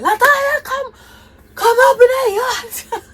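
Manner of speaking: high, loud call across the yard with a laugh; grandmother calling. Retold or imitated. Grandmother calling Latanya up into the yard, loud high laughing call. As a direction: call her in like grandma from the house. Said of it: "she said".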